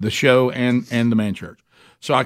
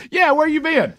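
high, bright quoted greeting from the agent; airport agent's greeting; 13 semitones higher than usual. Retold or imitated. Agent greeting a late traveler, voiced high and lively.

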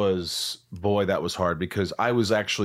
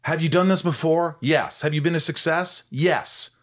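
higher, pointed questions to yourself; a touch higher, insistent self-questioning; quizzing self-talk; 7 semitones higher, 3 dB louder, slower than usual. Retold or imitated. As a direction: coach yourself out of worry. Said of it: "I talk to myself".

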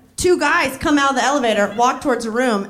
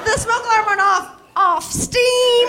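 high-pitched, drawn-out disbelief at the end; mock incredulity; 8 semitones higher than usual. Friend challenging the smoke alarm story, mock incredulous. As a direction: query it in comic outrage.

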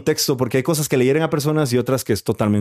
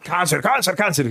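mock repeated catchphrase; 5 semitones higher than usual. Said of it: "lo decías mucho".

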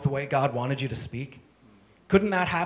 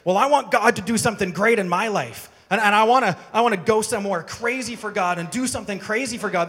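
a hint of an eager believer, louder; lightly voiced keen churchgoer; 5 semitones higher, 5 dB louder than usual.

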